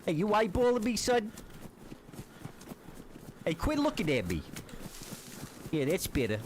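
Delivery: swaggering street thug voice; cocky nervous street slang. Acted. Tough-guy taunting a stranger.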